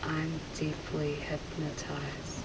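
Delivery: soft, obedient repetition; entranced echo. Real, not acted. Subject repeating the hypnotist's suggestion, softly entranced. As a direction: murmur it back as if in a trance.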